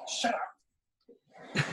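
hushed, breathy whisper to the dogs; whispered shush. Real, not acted. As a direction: hiss it quietly so the mic barely catches it. Telling the barking dogs off.

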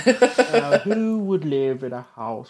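slow, drawn-out TV presenter voice; a hammy transatlantic presenter; Lloyd Grossman impression. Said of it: "That was very good".

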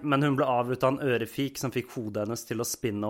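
ordinary delivery

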